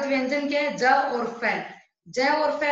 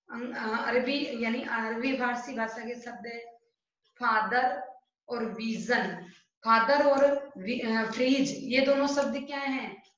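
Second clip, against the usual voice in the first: ordinary talk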